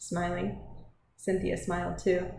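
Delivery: ordinary delivery, nothing marked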